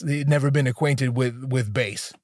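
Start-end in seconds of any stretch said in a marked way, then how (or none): none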